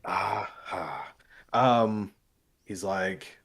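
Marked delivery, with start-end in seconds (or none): none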